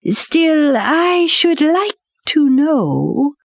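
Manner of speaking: high, drawn-out panther voice; curious panther. A narrator's reading. Bagheera curious about master words.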